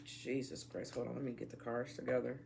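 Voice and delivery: ordinary talk, nothing marked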